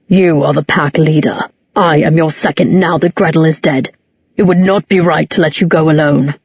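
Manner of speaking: lightly growled, even statement; flat growl. A narrator's reading. Half-wolf Alaric insists to his pack leader, flat light growl. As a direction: keep it level with a hint of wolfish growl. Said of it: "Alaric's growling voice was flat".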